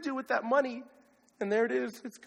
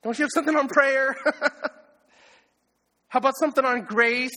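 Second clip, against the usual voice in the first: higher, louder mock complaint from a bored listener; whiny listener; 4 semitones higher, 4 dB louder than usual. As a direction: play the congregant groaning about money talks. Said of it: "if you're thinking".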